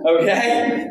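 ordinary talk, nothing marked

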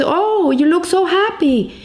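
delighted greeting. Retold or imitated. Friend meeting the happy man again, delighted greeting. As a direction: greet a joyful friend with lifted pitch.

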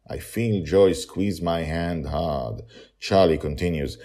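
ordinary delivery

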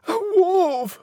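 very high, loud squeal; drawn-out squealing alarm; pig's cry. A narrator's reading. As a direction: wail it as a panicked piglet. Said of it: "Pig cried".

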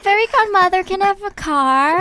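put-on high, loud little-kid plea; pleading child. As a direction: beg like an excited child. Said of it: "the fairy godmother is here today".

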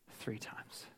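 fading whisper. Shown as a demonstration. Preacher whispering as Peter's memory fades. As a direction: drop to a bare whisper.

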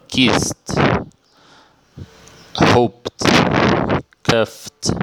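Kissed, Hoped, Cuffed each ending in a released T. Shown as a demonstration. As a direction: recap Kissed, Hoped, Cuffed one by one, "kist, hopt, kuft". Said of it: "Então, recapitulando". (Kissed, Hoped, Cuffed com som final de T)

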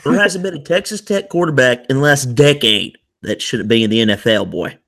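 keeping up the West Texas bit, boasting; fake West Texas accent. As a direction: brag like a Lubbock booster. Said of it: "fake, like, West Texas accents".

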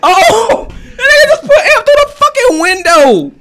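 high, loud, laughing disbelief; shocked laughing shout. Real, not acted.

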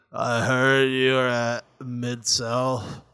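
very slow, halting interviewer impression; Hannibal. Mocking a horrible interviewer's questions, drowsy slow impression. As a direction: drag each question out painfully slowly. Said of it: "being a horrible interviewer".

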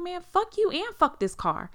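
high, loud cursing back; retold angry retort. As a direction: act out snapping back in an argument.

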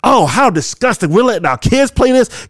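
high, sing-song mock disgust; hammed-up pearl-clutching parent; mock outrage. Parody of news outrage.

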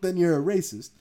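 usual speaking voice

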